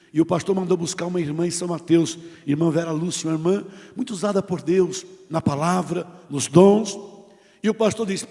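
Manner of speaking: ordinary delivery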